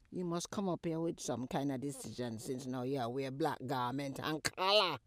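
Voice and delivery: normal talking voice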